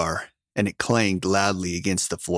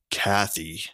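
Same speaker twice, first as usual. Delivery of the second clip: softly pleading name; begging; 3 dB quieter than usual. A narrator's reading. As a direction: beg her gently. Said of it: "he begged".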